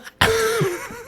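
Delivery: high burst of laughter; cracking up, high and sudden. Real, not acted. Host laughing hard at the electrician line.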